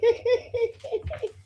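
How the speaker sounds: sudden high-pitched laugh; high burst of laughter. Real, not acted. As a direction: break into a giddy laugh. Amused laugh mid-rant.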